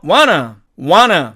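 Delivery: wanna, wanna, lightly; repeat wanna. Shown as a demonstration. Teacher leads students in saying wanna together. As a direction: model wanna for the class to repeat. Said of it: "같이 해볼까요".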